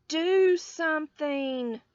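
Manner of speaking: loud, slow, pleading order; urgent plea. A narrator's reading. Nana begging Lucille's daddy for help, loud slow plea. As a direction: hiss it loud and desperate.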